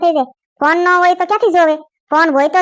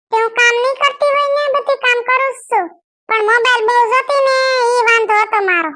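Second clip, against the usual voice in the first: put-on young woman protesting; 5 semitones higher than usual. Kayadi defending herself to the elders, meek protest. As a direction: protest meekly but firmly.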